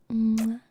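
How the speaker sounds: loud smacking air kiss; kiss sound. Pretend. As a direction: blow a kiss into the mic. Blows a kiss to chat.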